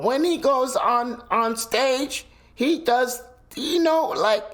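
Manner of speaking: high-pitched squeaky comedian stage voice. Retold or imitated. Showing how Cat Williams sounds on stage, high-pitched stage voice. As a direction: pitch it way up, like his onstage act. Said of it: "his voice, his pitch".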